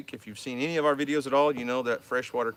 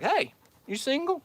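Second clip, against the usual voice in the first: high mock voice of the mom; higher voice, playing the nosy mom; imitating mom; 12 semitones higher than usual. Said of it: "she was like".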